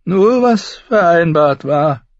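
high, loud, drawn-out contentment; satisfied madman. A narrator's reading. Brother lowering his sword, high slow satisfied voice. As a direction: let it out slowly, high and smiling. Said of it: "wie er lächelte".

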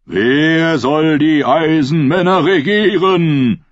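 slow, solemn call to the crowd; priest calling out. A narrator's reading. Priest calls to the assembled ironmen, slow solemn call. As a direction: proclaim it slowly across the gathering. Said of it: "rief Aeron Feuchthaar abermals".

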